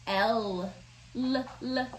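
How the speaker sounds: "L" said as a held l sound. Shown as a demonstration. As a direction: hold the tongue on "L". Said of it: "what about this one?".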